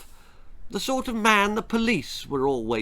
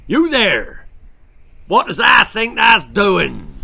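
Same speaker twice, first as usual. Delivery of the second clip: deep, gruff West Country shout, much louder; gruff farmer shout; 6 semitones higher, 9 dB louder than usual. A narrator's reading. Farmer catching the children stealing apples, gruff loud shout. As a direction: bellow at the children from across the orchard. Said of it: "deep, gruff voice shouted".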